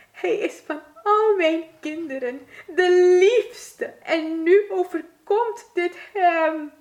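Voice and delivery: high, loud, slow sobbing wail; crying mother mouse. A narrator's reading. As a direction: huil het uit als een wanhopige moedermuis. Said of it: "Moedermuis begon te huilen". (hoog, luid en huilend jammeren)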